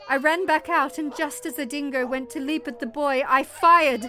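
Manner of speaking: loud, heightened climax telling; urgent narration. A narrator's reading. Mother recounts shooting the dingo to save her boy, loud and urgent. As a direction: drive the story to the shot with force.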